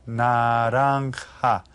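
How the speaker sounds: "Naranja" drawn out, its j a breath like H. Shown as a demonstration. Teacher showing the class the Spanish J, J said as H. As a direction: say "Naranja" slowly with j sounded as H. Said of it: "is pronounced like an H".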